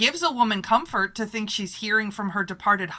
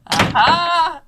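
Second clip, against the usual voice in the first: knowing aha; 8 semitones higher, 7 dB louder than usual. Acted. Photographer catches the hint.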